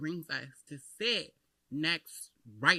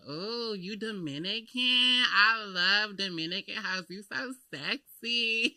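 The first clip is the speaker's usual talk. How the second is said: slow, drawn-out, higher-pitched mock flirting; imitated gushing castmate; 5 semitones higher, 4 dB louder, much slower than usual. Castmate fawning over the supposedly Dominican guest, drawn-out imitated gushing. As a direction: gush slowly, stretching every phrase.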